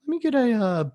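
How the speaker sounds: high, mocking customer ordering; mocking bar customer. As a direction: mimic someone ordering a Jack and Coke. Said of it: "you see people".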